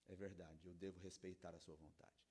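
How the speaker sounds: low, soft, resigned giving-in; acted compliant parent, very subdued; meek father. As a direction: play a dad quietly backing down. Acting a father meekly giving in.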